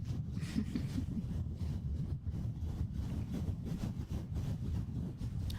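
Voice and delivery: soft breathy laugh under the breath; whispered laugh. Real, not acted. Streamer laughing softly at her own joke. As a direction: laugh almost silently into the mic.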